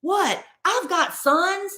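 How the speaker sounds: high, slow mock-proud husband voice; hammed-up husband at the party, high and slow; imitating husband. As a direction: ham up his drunk boast, high and drawn out. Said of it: "He's like".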